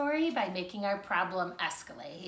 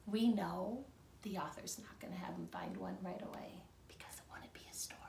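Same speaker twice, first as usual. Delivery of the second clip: whispered aside; 3 semitones lower, 12 dB quieter than usual. Real, not acted. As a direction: lean in and whisper the secret. Teacher's secret aside to class.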